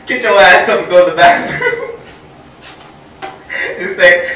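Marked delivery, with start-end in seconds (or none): 0.1-2.0 loud mock scolding command; bossy grandma order. Grandmother blaming the kid for her fart, loud scold. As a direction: bark the order like a grumpy nana.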